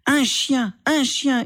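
shouting. Acted. Sister shouting back at her brother after the slap, enraged. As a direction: throw the words back at him, screaming. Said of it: "hurla Asma".

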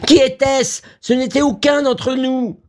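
high, panicked protest; alarmed high-pitched outcry; crying out. A narrator's reading. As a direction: insist frantically in a very high voice. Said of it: "s'écria encore Vera".

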